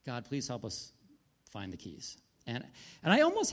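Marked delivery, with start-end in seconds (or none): 0.0-2.2 soft, trailing plea; quiet prayer. Retold or imitated. Husband on the trail quietly praying to God for the lost keys. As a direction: murmur a small prayer under the breath. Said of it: "I'm like".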